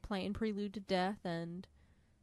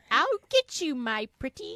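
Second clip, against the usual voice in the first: higher, louder cackling-witch menace; Wicked Witch; 7 semitones higher, 9 dB louder than usual. Retold or imitated. Henry as the Wicked Witch, higher and louder. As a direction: threaten like a storybook witch. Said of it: "he became the Wicked Witch".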